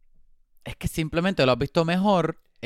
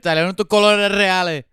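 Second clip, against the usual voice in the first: loud teasing gotcha, laughing; playful accusation, louder; laughing tease; 6 semitones higher, 8 dB louder than usual. Acted. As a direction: call out the slip with glee.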